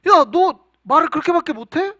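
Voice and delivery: loud, high exasperated rebuke; parental scolding. Acted. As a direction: act out telling off a teenager who cries like a baby. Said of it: "막 혼낸단 말이죠".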